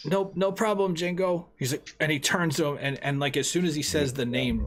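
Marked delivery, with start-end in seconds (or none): 0.1-1.5 high, panicky, stammering plea; terrified pleading. Acted. Shopkeeper pleading with the gangster, high and terrified. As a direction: beg him to take the money and go. Johnny slips the name.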